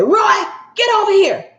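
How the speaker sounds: high, loud barked summons; bossy wife calling. Retold or imitated. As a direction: order him over like a domineering wife. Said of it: "you hear his husband say".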